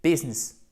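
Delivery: "business" as two syllables, BIZ-ness; English "business", stressed on the first syllable; correct English business. Shown as a demonstration. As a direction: say "business" with short i, middle i silent. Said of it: "non, c'est business".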